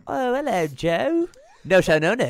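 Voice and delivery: high-pitched put-on English accent; bad English accent. Guest pretending to be an English actress, English accent. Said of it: "can you do an English, uh, accent".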